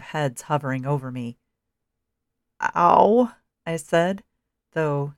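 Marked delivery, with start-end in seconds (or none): 2.6-3.3 loud grunted ow; pained groan. A narrator's reading. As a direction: groan the word out in pain. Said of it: "Grunted was closer. Groaned".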